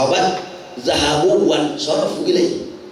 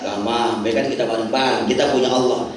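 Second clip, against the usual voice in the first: low, soothing leader's voice; calm reassurance; 9 semitones lower, faster than usual. Retold or imitated. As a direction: reassure a worried follower, pitched well down. Prophet reassures the worried disciple.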